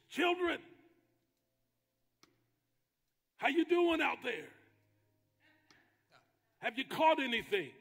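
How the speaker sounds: high, loud call across the water; shouting out. Retold or imitated. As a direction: holler out to people far off. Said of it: "he shouts out to him".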